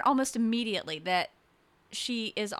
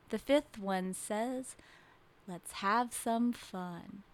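soft, measured nursery-rhyme recital; gentle sing-song verse; poem recital; 5 dB quieter, slower than usual. Acted. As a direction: recite a children's Halloween poem, slowing down. It's more of a poem.

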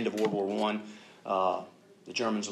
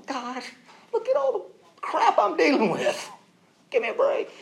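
very high mock whine; hammed-up squeal of self-pity; exasperated complaint; 6 dB louder than usual. Man protesting his troubles to God, high-pitched mock exasperation.

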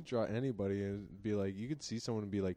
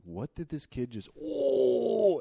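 building to a hammed-up outcry; mock shock; 6 semitones higher, 5 dB louder, much slower than usual. Teacher reacting to what a kid did, mock shock. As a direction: break into a high, loud 'oh'.

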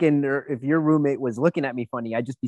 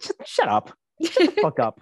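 hammed-up shout, higher on the second shut up; mock yell; 6 semitones higher than usual. Telling off Germany in her head, mock yell. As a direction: bark it like an imagined retort. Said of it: "it's like".

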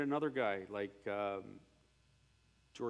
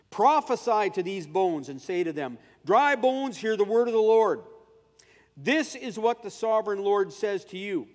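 loud, raised proclamation; preaching scripture; 7 semitones higher, 11 dB louder than usual. Acted. Scene: preacher reading Ezekiel to the congregation, loud proclamation. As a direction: declaim the verse with force.

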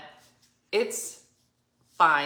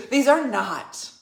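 mock indignant insistence; 5 semitones higher, 7 dB louder, slower than usual. [name] insisting again.

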